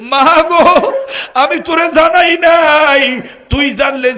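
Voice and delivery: high, loud sobbing wail; wailing cry. Acted. Son wails out his confession to his mother, high and loud.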